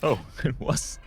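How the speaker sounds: laughing. Real, not acted. Pleased the joke came true.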